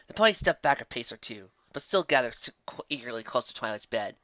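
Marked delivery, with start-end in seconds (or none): none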